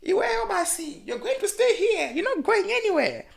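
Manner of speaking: very high mock voice; imitating a friend. Quoting Judy telling her to stay here, high-pitched imitation. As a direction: shoot the pitch way up for a teasing impersonation. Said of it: "You're like".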